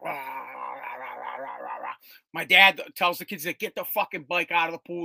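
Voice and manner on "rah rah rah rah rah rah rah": low, soft rough barking; growling dogs. Retold or imitated. Rottweilers snarling at the pool kids, low growled barks. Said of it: "They're like".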